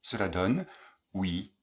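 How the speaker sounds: normal talking voice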